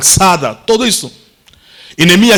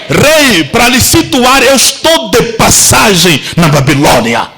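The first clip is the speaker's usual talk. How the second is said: bold declaration; 3 semitones higher than usual. Acted. Nehemiah declaring himself to the king, rising shout. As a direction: announce who you are, rising to a shout.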